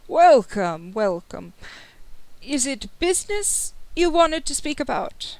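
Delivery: lilting noble host, rising high on the question; swooping, courtly welcome; welcoming host. Acted. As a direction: welcome guests grandly, then lift into a polite query. Adello welcomes guests with swept arm.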